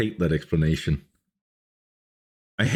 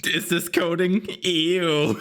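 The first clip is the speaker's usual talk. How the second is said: usual speaking voice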